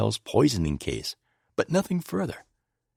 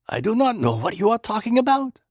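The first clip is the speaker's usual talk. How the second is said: Poirot voice; 14 semitones higher, 4 dB louder than usual. A narrator's reading.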